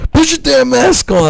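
high, strained mock yell. Other guy at the gym telling someone off, high-pitched mock yell. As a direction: yell it like an annoyed gym-goer. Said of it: "the other guy's gonna be like".

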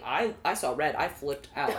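ordinary talk, nothing marked

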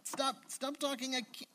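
sharp high cry trailing into a strained plea; retold cry of pain, half acted. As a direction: imitate a friend gripped by sudden pain. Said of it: "And he's like".

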